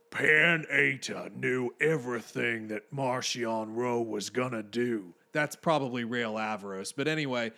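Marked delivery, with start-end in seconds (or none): 0.1-5.1 slow, lightly mimicked narrator voice; a loose imitation of the narrator, drawn out; slightly wrong copied voice. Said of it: "let me see if I can mimic that voice a little bit".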